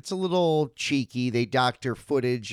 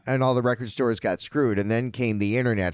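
ordinary talk

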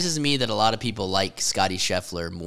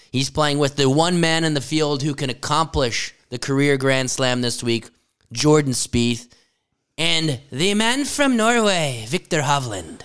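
drawn-out names, tournament announcer style; announcer intro; 3 semitones higher, slower than usual. Acted. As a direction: present each player with a rising build. Announces the featured group.

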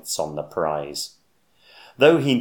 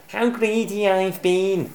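giggling splutter; 11 semitones higher than usual. Acted. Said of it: "spluttered Bruce with a giggle".

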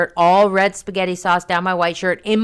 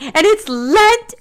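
high, slow, louder mock-crying; tearful wail; 14 semitones higher, 7 dB louder, slower than usual. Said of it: "I'm like".

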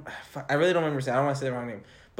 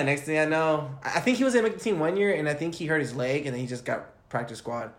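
ordinary talk, nothing marked

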